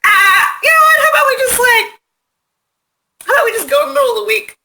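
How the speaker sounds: high, loud burst, mock panic, then rushing; frantic outburst. Anxious moviegoer pleading with friends, high frantic yelp. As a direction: blurt it out like a sudden frantic idea. Imagined outburst about gun fears.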